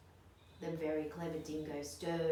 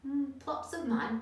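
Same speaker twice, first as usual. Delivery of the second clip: higher, louder amused question; amused dingo; 8 semitones higher, 5 dB louder than usual. A narrator's reading. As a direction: savour the idea with a chuckle in the voice. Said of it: "Dingo laughed".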